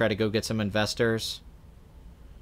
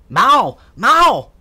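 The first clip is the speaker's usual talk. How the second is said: loud, high barked "Mao!" twice; mock Deer Hunter yell; 11 semitones higher, 10 dB louder than usual. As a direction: yell it like the captor in the roulette scene. Deer Hunter roulette scene yelling.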